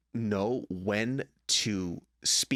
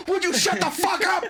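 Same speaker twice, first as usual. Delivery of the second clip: very high, loud mock outburst; explosive snap; 7 dB louder than usual. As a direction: blow up at a cheery greeting. Acted snap at a greeting.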